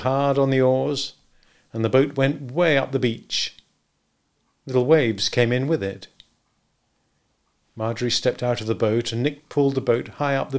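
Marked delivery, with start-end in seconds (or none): none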